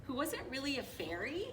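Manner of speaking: ordinary delivery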